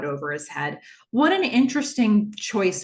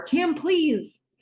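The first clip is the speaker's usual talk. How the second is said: pleading; 10 semitones higher than usual. Retold or imitated. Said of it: "Boz is pleading".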